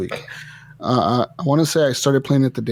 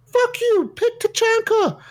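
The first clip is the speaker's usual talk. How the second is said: mocking shrill child's yell, much higher; screaming kid gamer; slower than usual. Mocking the kid raging over voice chat. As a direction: squeal it like an angry little kid. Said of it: "I'm like".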